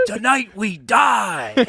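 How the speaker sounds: high, slow mock war cry; imitated battle cry. Imitating a friend on speed running around, drawn-out high battle cry. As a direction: stretch it out like a crazed rallying call.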